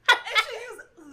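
usual speaking voice

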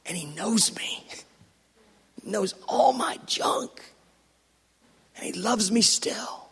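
soft, intimate whisper; whispered confession of being known. Real, not acted. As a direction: let it drop to a confiding whisper. Intimate confession about God.

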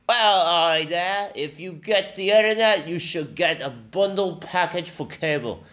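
put-on British accent. Internet salesman pitching a customer, in exaggerated British accent. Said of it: "the guy I had was British".

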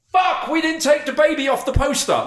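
high-pitched sudden realisation; hammed-up panicked outburst; mock panic. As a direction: blurt it out high, as if just caught out. Acts out festival's sudden panic.

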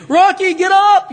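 loud high yell up to the ring; a fan's ringside cry of Adrian. Retold or imitated. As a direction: shout him back onto his feet. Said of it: "That was my best Adrian".

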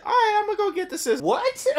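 put-on high, quick little girl. Girl cheerfully heading off for scissors, high kid voice. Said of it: "she said".

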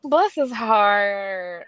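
very slow, stretched sympathy; drawn-out mock pity. Real, not acted. Woman pitying the slow ex, drawn-out mock sympathy.

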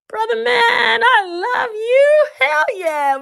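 high, crying shout of love; emotional shout. Retold or imitated. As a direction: cry it out to your husband, overcome. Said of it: "I'm like".

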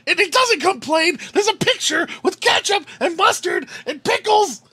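high, loud Hulk-out rant, hammed up; mock furious customer tirade; super-Karen meltdown. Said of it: "the Incredible Hulk comes out of me".

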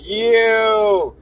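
long high "yo"; drawn-out amused exclamation; laughing disbelief. Real, not acted. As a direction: cry out, stretched and high, cracked up at the joke. Laughing shock at own joke.

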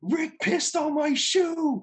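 very high, drawn-out mock wail; Salty screaming. Storyteller imitating his friend's complaint, screaming. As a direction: stretch it into a high comic scream. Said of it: "he's screaming".

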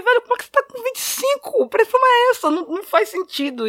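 very high-pitched retold disbelief; incredulous shock. Relative reacts to uncle's dangerous blood pressure, incredulous shock. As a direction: exclaim it way up high, astonished.

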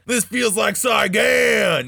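shouted high and slow, hammed-up soldier bark; gruff veteran agent. Retelling the FBI agent in the helicopter, mock shout. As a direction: yell it like a war-crazed agent reliving Nam. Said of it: "And he's literally like".

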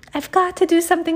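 fast, high, loud outburst; panicked yell. Acted. Emily freaking out after the tooth, fast and loud. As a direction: blurt it in a panic. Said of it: "she yelled".

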